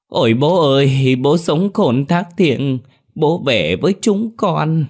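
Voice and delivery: high, loud, slow theatrical wailing; loud mock wailing. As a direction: wail for the inheritance, hammed-up. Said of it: "khóc ầm lên". (khóc ầm ĩ giả tạo, giọng cao, chậm)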